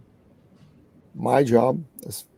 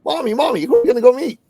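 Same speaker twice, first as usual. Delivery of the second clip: put-on much higher, eager child's voice; excited little boy; 14 semitones higher, 5 dB louder, faster than usual. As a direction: pipe up like a little boy bursting to know. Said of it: "the way little boys do, excited".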